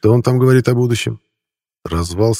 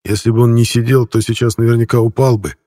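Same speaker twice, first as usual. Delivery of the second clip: ordinary delivery, nothing marked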